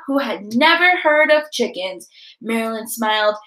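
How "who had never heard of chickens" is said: ordinary delivery, nothing marked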